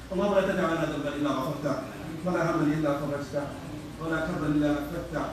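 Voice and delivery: closing dua recited fast and low; prayer. Acted.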